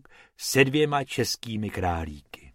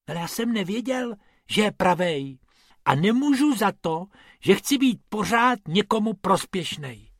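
higher, fast whiny excuse; whining; 7 semitones higher than usual. Acted. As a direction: whimper your excuse. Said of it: "fňukal Bobek".

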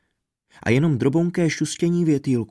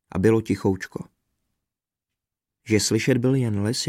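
usual speaking voice

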